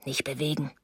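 very soft, low murmur; hushed in the ear. A narrator's reading. He warns her in the dark, hushed and low. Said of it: "sagte er im Dunkeln in mein Ohr".

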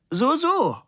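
loud, high-pitched, nodding agreement; smiling reply. A narrator's reading. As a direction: smile and play the satisfied listener. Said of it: "Labude nickte lächelnd und meinte".

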